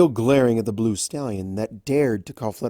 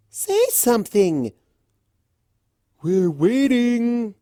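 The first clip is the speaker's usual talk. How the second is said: high, slow, loud taunt; heckling crowd pony; 12 semitones higher, 5 dB louder, slower than usual. Acted. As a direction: jeer at her impatiently.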